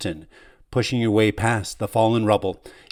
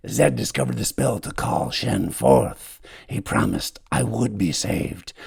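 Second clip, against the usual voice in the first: dying whisper; 4 semitones lower than usual. Acted. Dying man fades to whisper.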